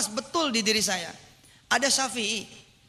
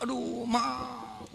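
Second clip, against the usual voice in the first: apologetic; 11 dB quieter, much slower than usual. Retold or imitated. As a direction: beg pardon, drawn out slowly and sheepish. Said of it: "Terus dia minta maaf".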